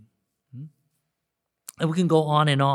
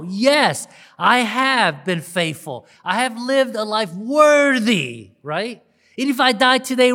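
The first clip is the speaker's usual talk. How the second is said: loud, raised, resounding affirmation; confident declaration; 5 semitones higher, 8 dB louder, slower than usual. Acted. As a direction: declare it emphatically, high and loud. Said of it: "without hesitation and with full confidence".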